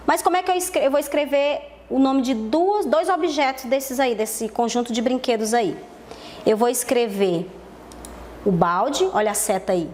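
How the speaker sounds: normal talking voice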